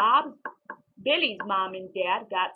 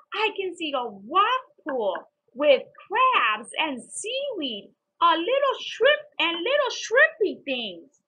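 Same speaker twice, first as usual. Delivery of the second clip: wonder; 6 semitones higher, 6 dB louder, slower than usual. A narrator's reading. Child marvelling at bucket.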